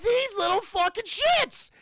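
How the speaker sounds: high, loud, drawn-out cry of disgust; outraged. Retold or imitated. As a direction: curse them in a high, slow wail. His outraged reaction to the bullies.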